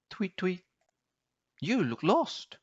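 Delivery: high, light bird voice with a chirped tweet; chirpy bird. Acted. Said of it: "the bird said".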